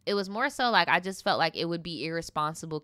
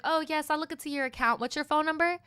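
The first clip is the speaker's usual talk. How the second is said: mock customer service voice; 7 semitones higher, faster than usual. Imitating worker to customer.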